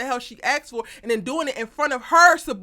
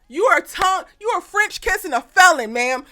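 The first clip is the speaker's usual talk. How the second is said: loud, higher, incredulous call-out; exasperated scolding; 5 semitones higher, 6 dB louder than usual. Acted. Recapper scolding Sabrina on screen, loud and incredulous. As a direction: yell at her for her bad choice.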